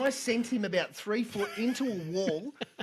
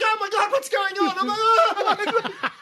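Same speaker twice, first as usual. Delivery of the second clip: high, loud mock shock; hammed-up shocked nurse; 12 semitones higher, 8 dB louder than usual. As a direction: gasp it out like you've walked in on something.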